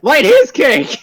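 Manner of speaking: high, loud echo of a goblin cry; playful high-pitched shout; mock goblin yell. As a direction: chime in as a yelling goblin. Player echoes the goblins' yell.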